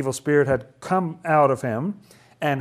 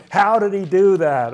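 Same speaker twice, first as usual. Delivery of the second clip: higher, louder incredulous question; amazed; 5 semitones higher, 6 dB louder than usual. Acted. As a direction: play a baffled, excited onlooker. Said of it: "I would say".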